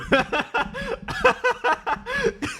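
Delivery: high squealing laugh that breaks out; burst of high laughter. Real, not acted. Laughing hard at the imagined angry mom. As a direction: crack up at the thought.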